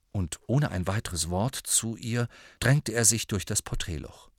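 usual speaking voice

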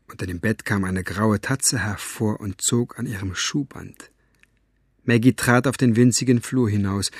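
usual speaking voice

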